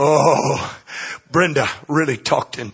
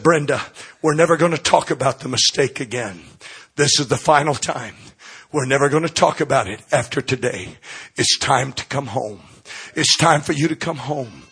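soft, lower, reassuring; tender; 5 semitones lower, 5 dB quieter than usual. Acted. Pastor comforting a crying Brenda in hospital, tender and soft. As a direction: gently welcome her back. Pastor gently forgives a grieving woman.

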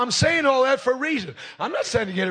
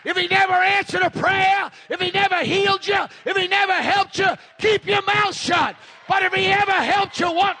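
raised, shouted preaching rebuke; fiery sermon rebuke; 7 semitones higher than usual. Real, not acted. Preacher scolding the silent congregation, fervent shouting. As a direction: drive it home to the pews.

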